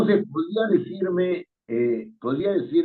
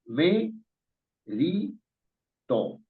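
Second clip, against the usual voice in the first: ME, RI, TO with a pause after each; syllable by syllable; 2 semitones higher, much slower than usual. Shown as a demonstration. Teacher showing three syllables, me, ri, to spaced apart. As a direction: count out me... ri... to separately. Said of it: "acá hay tres sílabas".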